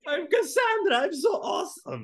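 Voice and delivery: hammed-up mocking impression; bad acting. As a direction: overdo the boastful hero voice. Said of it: "You sound nothing like that".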